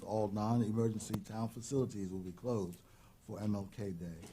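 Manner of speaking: ordinary delivery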